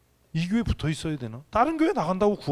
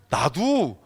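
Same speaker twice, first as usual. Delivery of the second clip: usual speaking voice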